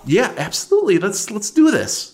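can't wait to go; excited. Acted. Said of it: "she appears to be very excited".

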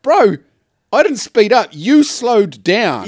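acted-out protest; retold indignant retort. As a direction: argue back, dragging out the last words. Acting out his retort in argument.

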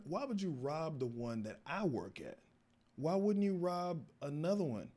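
mild mock outrage. Employee scolding friend for robbing his store, exasperated. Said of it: "part of me was getting mad".

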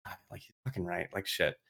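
whispered curses, half to himself; despairing whisper. Retold or imitated. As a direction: hush it like a man realising he's beaten. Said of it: "Miller's like".

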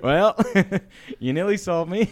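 high, loud laughing quip; amused mock praise; laughing tease. Teasing the girl with the fake ID, laughing high.

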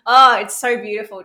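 loud, moved outburst; moved exclamation. Real, not acted. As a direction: gush with real feeling. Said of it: "I'm getting emotional".

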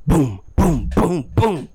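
high, punchy booms; four separate thumps mimicking a fist on a door; imitated door pounding. Host acting out knocks at his front door, punchy booms. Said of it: "four loud pounds".

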